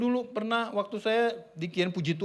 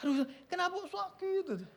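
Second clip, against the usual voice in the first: pained groan; 9 semitones higher, 8 dB quieter than usual. Retold or imitated. Husband groaning beside sick wife.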